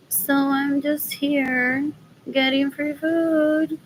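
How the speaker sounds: slow, stretched-out explanation; slow drawl. Real, not acted. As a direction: draw it out lazily.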